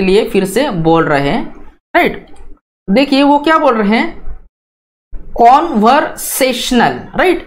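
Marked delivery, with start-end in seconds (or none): 5.1-7.0 कन्वर्सेशनल spoken slowly and clearly; slow teacher's model of कन्वर्सेशनल; model pronunciation. Shown as a demonstration. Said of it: "इसका pronunciation कैसे किया जाता है".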